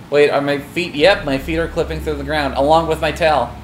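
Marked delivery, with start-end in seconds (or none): none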